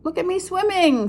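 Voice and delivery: high squeaky little voice; young Roo squeaking proudly from the pool. Acted. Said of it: "squeaked Roo".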